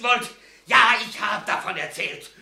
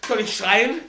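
louder, higher defiant threat; 3 semitones higher, 6 dB louder than usual. Acted. Prisoner threatening captors with screaming, loud and defiant. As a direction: dare them, voice climbing.

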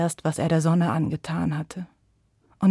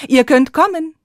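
loud higher call; 8 semitones higher, 8 dB louder than usual. Acted. Moon calling his children out, loud. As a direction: call them up joyfully. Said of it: "rief da der Mond".

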